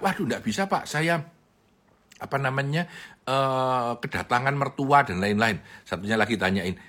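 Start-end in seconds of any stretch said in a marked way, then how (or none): 0.0-5.6 excuse-making employee. Retold or imitated.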